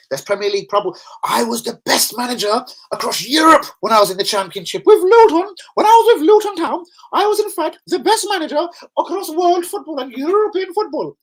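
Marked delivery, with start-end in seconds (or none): 1.2-11.2 much higher, squeaky mock voice of a ranting manager; mocking the Southampton manager. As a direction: lampoon a boastful manager who has lost it, pitched way up. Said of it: "He's lost it".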